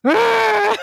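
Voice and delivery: loud high furious roar, fists clenched; enraged growl. Retold or imitated. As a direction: let out a raging yell. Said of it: "clenched my little fists and was just like".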